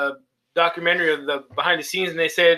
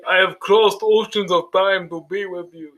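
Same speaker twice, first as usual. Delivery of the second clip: slow, drawn-out movie vampire voice; Dracula; 3 semitones higher than usual. Retold or imitated. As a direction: intone it as a grand declaration of undying love. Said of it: "What does he say in the movie?".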